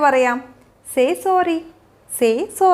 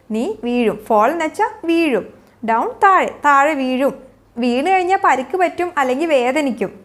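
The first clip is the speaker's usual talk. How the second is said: usual speaking voice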